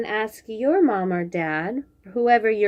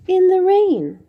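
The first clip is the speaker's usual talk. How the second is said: higher and louder, dramatic title read; title said with flair; 8 semitones higher, 7 dB louder than usual. Shown as a demonstration. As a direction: present the title like a showpiece. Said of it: "title repeated with emphasis".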